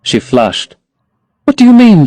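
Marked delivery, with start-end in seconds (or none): 1.4-2.0 put-on higher, young girl's plea; lightly raised girlish protest; Sybil crying out. A narrator's reading. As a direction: voice the eager young actress.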